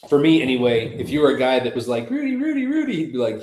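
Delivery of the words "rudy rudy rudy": high-pitched fawning "like" act; high mocking sycophant; mock suck-up. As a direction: imitate an eager brown-noser, voice pitched way up. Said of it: "he didn't want you to kiss his ass".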